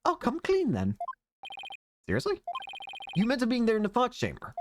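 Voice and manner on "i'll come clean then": high, theatrical dandy voice; flamboyant witness voice. Acted. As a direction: play the preening dandy witness. Witness voiced in game playthrough.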